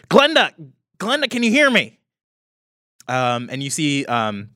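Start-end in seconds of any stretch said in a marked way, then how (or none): 0.1-2.0 high, loud call into the device. Acted. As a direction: call out to reach Glenda. Tin Man calls via disc.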